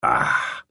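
soft, voiceless exhaled "ah"; quiet "ah". A narrator's reading. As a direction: let out a quiet ah on the breath. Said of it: "Så sagde han et stille".

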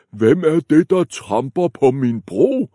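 loud roaring troll; troll roaring at the biggest goat. Acted. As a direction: roar it from below. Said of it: "brølede trolden".

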